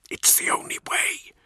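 low hissed whisper; urgent resistance whisper. Acted. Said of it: "Nemiel hissed".

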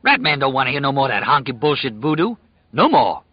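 Rat Man's rant. A narrator's reading. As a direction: rant it out, rattled. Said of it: "he cried".

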